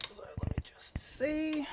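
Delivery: slow breathy whisper to self. Real, not acted. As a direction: whisper it slowly while checking. Checking camera, murmuring to self.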